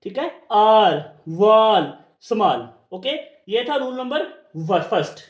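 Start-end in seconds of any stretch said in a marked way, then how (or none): 0.5-2.7 "आर, वाल, स्मॉल" slow, with open aa; long aa sound. Shown as a demonstration. Teacher drilling the big आ sound, slow aa in "आर, वाल, स्मॉल". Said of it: "बड़े आ की sound में क्या आया".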